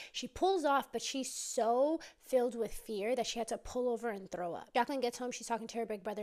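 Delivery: ordinary delivery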